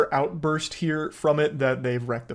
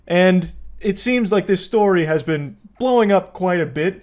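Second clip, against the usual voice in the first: ordinary talk, nothing marked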